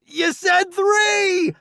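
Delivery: high, slow indignant cry; protesting. Acted. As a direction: call out in outrage. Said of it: "I protest".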